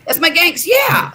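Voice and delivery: loud proud exclaim; excited shout. Real, not acted. Proudly declaring gangster name.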